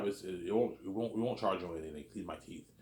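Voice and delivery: ordinary delivery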